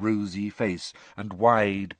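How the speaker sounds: ordinary delivery, nothing marked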